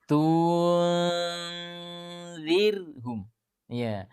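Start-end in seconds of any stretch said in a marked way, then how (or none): 0.1-1.3 "tunzirhum" at half speed, each sound pulled apart; slowed-down demo. Shown as a demonstration. Teacher slowing the word down for students to follow. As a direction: drag "tunzirhum" out syllable by syllable. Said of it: "Kalau dilambatkan lagi".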